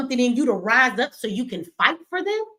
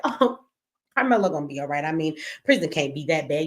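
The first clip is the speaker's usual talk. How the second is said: mock reassurance; 7 semitones lower than usual. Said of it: "You want to hear me say".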